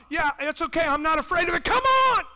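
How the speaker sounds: loud, high, rousing call; fervent preaching shout; inviting Jesus. Pretend. Jesus answers objection.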